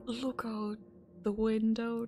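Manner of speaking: normal talking voice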